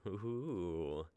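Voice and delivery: ordinary talk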